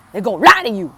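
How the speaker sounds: a sudden put-on spooky voice, loud and high, on lie; odd dramatic voice. Shown as a demonstration. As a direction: throw the line out in a strange, hammed-up voice. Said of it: "I don't know why I said it like that".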